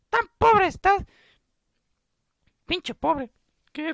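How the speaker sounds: high falsetto, indignant; cartoonish girly outrage; scornful girl friend. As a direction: scold in a squeaky put-on girl's voice. Girl mocks the boy.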